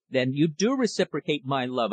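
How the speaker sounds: ordinary delivery, nothing marked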